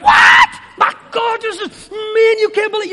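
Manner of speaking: high, loud, startled yelp of joy; shouted overjoyed disbelief; going nuts. Retold or imitated. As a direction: shriek like you just won for life. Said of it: "you're gonna go nuts".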